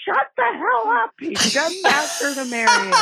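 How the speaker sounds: very high-pitched exasperated yell; imitated sister's yell. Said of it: "And my sister yells".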